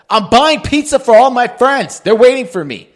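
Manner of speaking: loud, high, confident retort; brazen confidence. Acted. Line-cutter answering the man who challenged him, brazen confidence. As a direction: announce it loudly, totally sure of yourself. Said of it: "he said it with such confidence".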